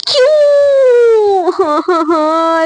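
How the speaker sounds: a high, drawn-out mock wail on the first word, then small sobs; hammed-up sobbing. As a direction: fake crying in her heart, played for laughs. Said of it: "ऐसा ना दिल ही में रो रही होती है". (बढ़ा-चढ़ाकर नकली रोना, ऊँची खिंची हुई सिसकी)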